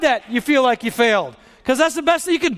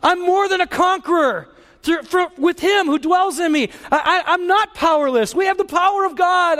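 wife's bold declaration; 6 semitones higher than usual. Retold or imitated. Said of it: "She goes".

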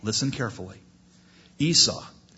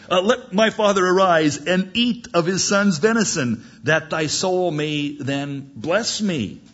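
higher, louder acted plea to a father; eager Esau; 6 semitones higher, 4 dB louder than usual. Son bringing meat to his father, acted call. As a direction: call out to father, slowing at the end.

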